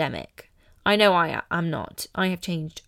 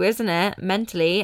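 normal talking voice

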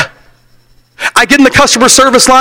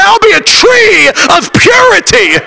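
high shouted challenge, falling on purity; shouted call; 10 semitones higher than usual. Acted. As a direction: shout it out like a vow. Preacher's ringing call.

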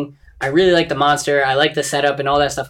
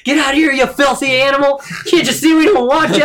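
mock disgusted man; 9 semitones higher than usual. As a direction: shoo away a filthy animal. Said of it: "he's just like".

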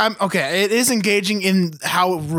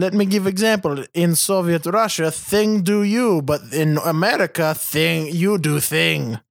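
put-on Russian accent. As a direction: explain it like a mock Russian comic. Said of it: "antebellum Russia".

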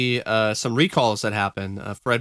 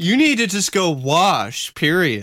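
mock scolding; 6 semitones higher, 4 dB louder than usual. Mocking reply to smelly guy.

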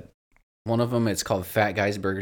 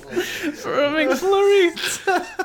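laughing; 4 dB louder, much slower than usual. Real, not acted. Laughing at the Capitol joke.

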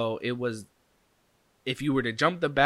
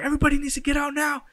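high, loud, fast order; guy yelling at everyone to leave now; urgent shout; 5 dB louder, much faster than usual. Retold or imitated. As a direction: yell at the whole party to clear out. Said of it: "he's like".

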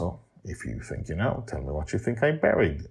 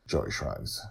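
usual speaking voice

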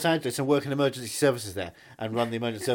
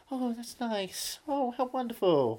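soft, slow, higher-pitched prim delight; buttoned-up politeness; 8 semitones higher, 6 dB quieter, slower than usual. Acted. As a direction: coo it demurely, slow and quiet. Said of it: "always very buttoned up".

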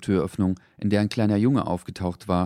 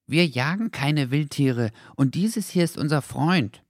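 slow, higher voice, mildly protesting; protesting dog voice; 8 semitones higher than usual. A narrator's reading. Dogs protesting to Henry, mildly hurt. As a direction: defend yourself, a little hurt.

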